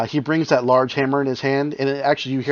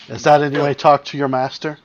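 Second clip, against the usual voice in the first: cold gloating villain with a sneer; gloating ghoul voice; 3 semitones higher, 5 dB louder than usual. Acted. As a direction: gloat over a former slave.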